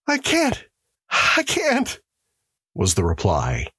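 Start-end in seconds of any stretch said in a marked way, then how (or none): 0.1-2.0 much higher, tearful refusal; sobbing. Acted. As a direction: refuse through tears. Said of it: "He was sobbing".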